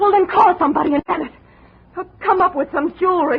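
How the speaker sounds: high and insistent, no patience; urgent demand. Acted. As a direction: push him hard for the money. Andrea demands money.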